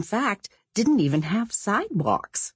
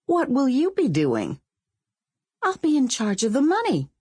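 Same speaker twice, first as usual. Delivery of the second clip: put-on higher girl's voice, pleased with herself; Sonia self-important; 9 semitones higher than usual. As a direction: claim the easy job proudly. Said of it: "Sonia said".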